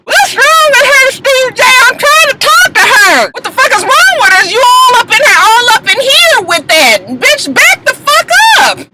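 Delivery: high, squealed confrontation; hammed-up screechy imitation of a quarrel; mock outraged. Imitated bartender confronting the star, hammed-up high outrage. Acted quarrel, Shay shouting at Joseline.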